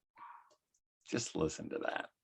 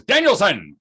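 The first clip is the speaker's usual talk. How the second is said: stern call; 8 semitones higher, 7 dB louder than usual. Retold or imitated. Sensei calls student sharply.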